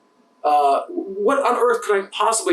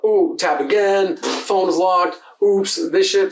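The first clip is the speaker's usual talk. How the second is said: imitating a slow customer; much slower than usual. Imitating a fumbling phone customer.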